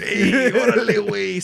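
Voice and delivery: laughing, hammed-up Chicano accent. Shown as a demonstration. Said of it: "That's all my Chicano accent is".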